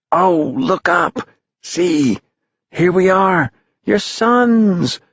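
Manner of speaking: put-on very high, elated boys' voice; joyful calling. Boys flying over town to their families, high elated calls. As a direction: call down with wonder and joy.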